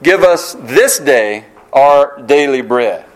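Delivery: slow, leaning on "this" and "daily"; slow sermon reading, weight on "this day" and "daily"; emphasized quote. Shown as a demonstration. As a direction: read "Give us this day our daily bread" slowly, stressing "this day" and "daily". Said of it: "I emphasize the word today and daily".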